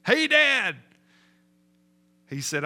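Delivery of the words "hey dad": high, loud holler to Dad; imitating a son calling out; boy's yell. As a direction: shout it like a boy mid-jump. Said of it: "and then yelled".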